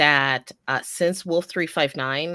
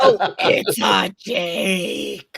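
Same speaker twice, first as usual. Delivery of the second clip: slow mock; 5 dB louder, much slower than usual. Slow joking correction.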